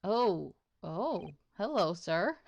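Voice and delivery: ordinary talk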